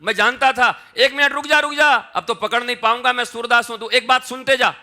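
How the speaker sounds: put-on high, loud pleading shout of a blind old man; shouting after him. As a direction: yell after the fleeing boy to stop.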